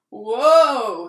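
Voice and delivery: loud sudden whoa; startled shout. A narrator's reading. Dad startled as the armchair creaks, loud whoa. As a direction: cry out as the chair creaks. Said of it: "said Dad".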